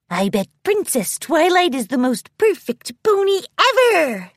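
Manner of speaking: high-pitched gushing admiration; high, delighted boast building to the end; excited filly. A narrator's reading. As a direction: gush like a starstruck filly. Overexcited filly friend answers in story.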